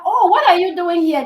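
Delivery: mock surprise. Friend greeting the couple in the hallway with hammed-up surprise. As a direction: act startled to bump into them.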